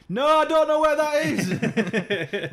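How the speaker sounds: drawn-out, very high, loud reply; shouting over music you can hear; loud headphone answer. Retold or imitated. Answering an old lady loudly with headphones on, slow high shout.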